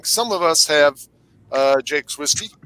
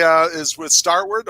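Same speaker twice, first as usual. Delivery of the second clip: ordinary delivery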